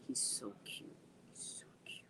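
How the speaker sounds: whispered dreamy gush. Real, not acted. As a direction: breathe it out softly.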